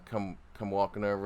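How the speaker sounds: ordinary talk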